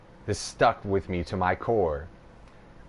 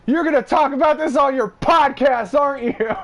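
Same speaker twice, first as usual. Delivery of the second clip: imitating Justin; 7 dB louder than usual. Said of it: "he gave it to me".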